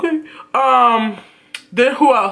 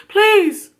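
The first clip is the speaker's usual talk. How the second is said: exasperated plea; 9 semitones higher than usual. Real, not acted. Pleading emphasis.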